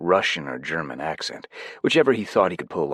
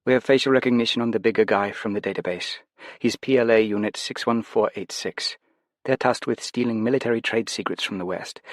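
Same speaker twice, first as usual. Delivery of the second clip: lightly higher female voice; a hint of amused handler; Nisha in earpiece; 6 semitones higher than usual. Nisha teasing Jed through his earpiece, lightly voiced.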